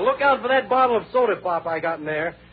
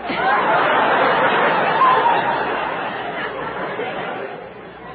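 shrieking laugh; 5 dB louder than usual. Real, not acted. Huge laugh.

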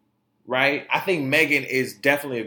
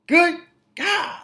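mock exclamation; 13 semitones higher than usual. Mock shock at royal drama.

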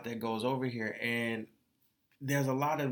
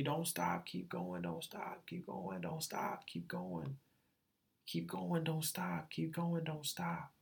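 hushed repeating; 5 semitones higher, 9 dB quieter, slower than usual. Retold or imitated. Repeating everyone's advice, hushed.

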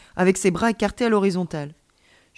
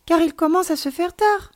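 put-on raised, louder childlike voice; eager child; 10 semitones higher, 6 dB louder than usual. A narrator's reading. Child urging friend to stay because it's late, eager. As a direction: press the invitation, pitched high.

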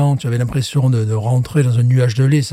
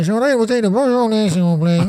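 higher, put-on customer voice speaking into an intercom; mock intercom voice; 8 semitones higher than usual. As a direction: order the wine like you're talking to a speaker box. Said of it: "c'est pour ça que tu fais cette voix-là".

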